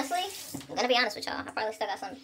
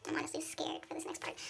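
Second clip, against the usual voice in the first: softer, hushed and worried; nervous whisper; 7 semitones higher, 9 dB quieter than usual. Real, not acted. Vlogger nervous about the next makeup step, whispering. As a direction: whisper it like a confession.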